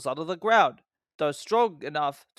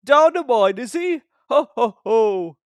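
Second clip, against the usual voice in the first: louder, higher hearty ho ho; booming laugh; 7 semitones higher, 10 dB louder than usual. A narrator's reading. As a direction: laugh at Thomas's plight. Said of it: "laughed Gordon".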